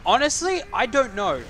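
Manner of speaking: loud high flustered reply; excited exclamation. Real, not acted. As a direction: blurt it out, flustered.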